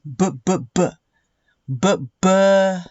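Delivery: "b, b" as repeated short "buh" sounds; letter B sound. Shown as a demonstration. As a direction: sound out "b" as "buh", in little bursts. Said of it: "for the letter B".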